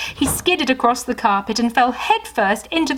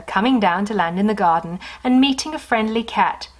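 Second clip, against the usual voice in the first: ordinary delivery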